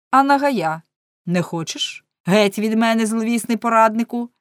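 angry threat. A narrator's reading. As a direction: drive off the unwelcome adviser loudly. Count angrily threatens his unwanted adviser. (гнівний гучний окрик)

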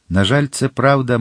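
ordinary delivery, nothing marked

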